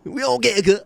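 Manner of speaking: a hint of the clip guy's voice; echoing the clip guy. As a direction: parrot his line back, amused.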